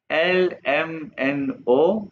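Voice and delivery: एल एम एन ओ spelled out very slowly; slow alphabet demo. Shown as a demonstration. Teacher slowly recites letters for students. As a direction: stretch each letter एल एम एन ओ. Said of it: "दोबारा pronounce करूंगा".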